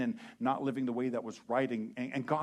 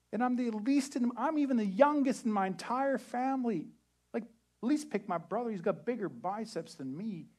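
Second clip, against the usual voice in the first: much higher pleading whine; whiny Gideon; 11 semitones higher, 5 dB louder than usual. Retold or imitated. Gideon begging God to choose someone else, whining. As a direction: beg God to pick the brother. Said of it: "Gideon replied".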